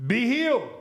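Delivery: loud, hammed-up healer's command; a disciple grandly trying to heal the boy; mock faith healer command. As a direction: boom it out like a showy faith healer. Disciple commands healing mockingly.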